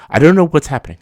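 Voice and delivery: exasperated outburst. Real, not acted. Vlogger venting about the show, loud exasperated outburst. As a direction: blurt it out in disbelief.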